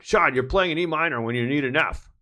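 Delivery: band director. Retold or imitated. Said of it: "Band director would totally stop the band".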